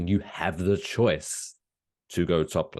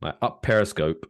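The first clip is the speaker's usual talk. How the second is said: normal talking voice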